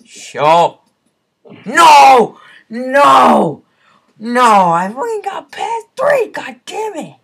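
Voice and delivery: loud, high cry of victory; triumphant shout. Real, not acted. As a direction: yell it as you finally win. Finally passes level three.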